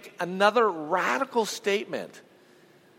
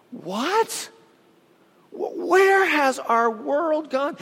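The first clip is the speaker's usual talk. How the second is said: higher, slower, disbelieving "What?"; incredulous; 5 semitones higher, slower than usual. Real, not acted. Pastor to congregation, aghast at the news, disbelieving. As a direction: let the shock rise in the voice.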